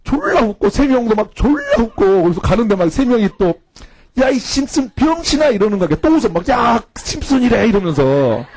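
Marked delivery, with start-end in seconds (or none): 4.2-5.5 high-pitched mocking call; mock heckle, pitched way up; retold jeer. Friends jeer after his mishap. 6.5-7.7 high, drawn-out mocking call; slow mock heckle, pitched up; retold jeer. Friends jeer after his mishap.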